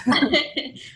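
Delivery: short amused laugh; light real laugh. Real, not acted. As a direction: laugh along at the joke. Laughing at almost in person.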